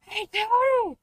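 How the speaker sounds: shrill mock bark; high dog voice. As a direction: yap it like a street dog. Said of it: "Doing the".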